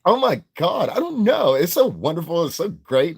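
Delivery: put-on very high, gushing California Valley girl accent; mock California Valley girl, high and airy. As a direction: squeal it like a California Valley girl in love with everything. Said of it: "Wanna be Valley".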